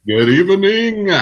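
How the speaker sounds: grand mock-formal greeting; hammed-up broadcaster hello.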